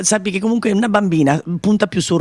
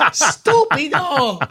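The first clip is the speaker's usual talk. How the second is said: laughing; 7 semitones higher, much slower than usual. Real, not acted. Laughing at co-host's joke.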